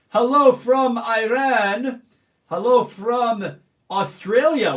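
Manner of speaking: loud, slow, sing-song hello; stretching hello like a welcome call; drawn-out greeting. Real, not acted. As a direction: call each greeting out long and bright. Reading live chat shout-outs.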